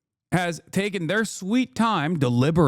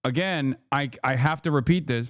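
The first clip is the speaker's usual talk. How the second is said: ordinary talk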